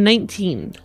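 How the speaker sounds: usual speaking voice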